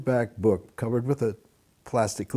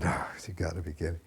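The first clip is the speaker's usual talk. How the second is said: dismayed; 4 semitones lower, 9 dB quieter than usual. Retold or imitated. Retold inner groan.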